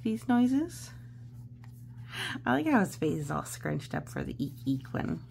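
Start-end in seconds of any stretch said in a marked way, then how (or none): none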